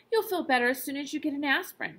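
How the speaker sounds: ordinary delivery, nothing marked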